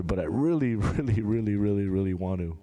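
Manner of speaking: ordinary talk, nothing marked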